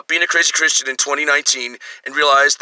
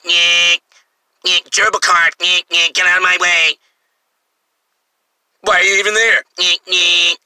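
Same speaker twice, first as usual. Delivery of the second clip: mock South Park liberal; 2 semitones higher than usual. Comedian mocking a smug Prius driver in a whiny cartoon voice. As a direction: sneer like a spoiled cartoon brat in a little car. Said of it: "So it's South Park".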